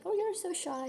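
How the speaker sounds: high, mock-teasing jab; teasing remark. She teases a friend for being shy, in a high mock voice. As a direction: tease lightly, voice jumping up. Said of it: "say something like".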